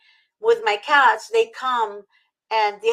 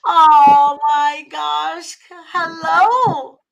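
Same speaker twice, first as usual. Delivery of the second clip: louder and higher, drawn out and tearful; overwhelmed delight; 5 semitones higher, 8 dB louder, much slower than usual. Real, not acted. Guest first seeing the polydactyl cat, tearful and delighted. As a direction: gasp at the sight, near tears.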